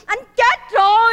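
loud, slow, drawn-out announcement of a death; hammed-up widow's lament; mock mourning wail. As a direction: wail the bad news, stretching it out. Báo tin chồng chết, đang khóc.

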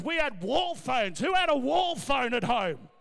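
usual speaking voice

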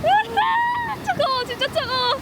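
high shriek at the cold; in ice cold water. Real, not acted. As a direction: squeal as icy water hits. Stepping into cold sea.

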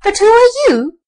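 high, loud squeaky question; squeaky rat. A narrator's reading. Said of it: "answered the brown rat".

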